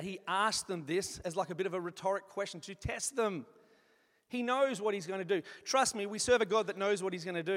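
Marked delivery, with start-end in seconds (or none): none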